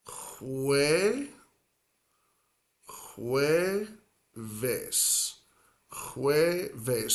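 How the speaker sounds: jueves broken into HWEH, pause, VES; soft entry. Shown as a demonstration. Spanish teacher sounding out Thursday in pieces, soft entry. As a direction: ease into jueves on a soft H, syllables apart. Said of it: "a soft point of entry into the word".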